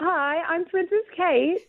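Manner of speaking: high, slow, badly faked British princess; attempted British accent. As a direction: put on a drawn-out British royal accent. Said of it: "in your British accent".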